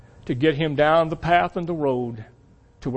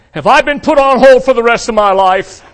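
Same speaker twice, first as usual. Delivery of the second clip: much louder, higher cartoon yell; 7 semitones higher, 11 dB louder than usual. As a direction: yell it skyward as Ziggy. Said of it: "yells out".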